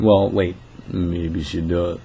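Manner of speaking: ordinary talk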